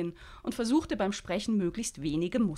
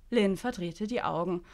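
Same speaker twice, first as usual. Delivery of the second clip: usual speaking voice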